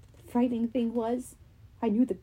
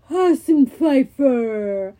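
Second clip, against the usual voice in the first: slow, loud giant voice; Cyclops voice; 7 dB louder, much slower than usual. Acted. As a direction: drag the name out like a brutish giant.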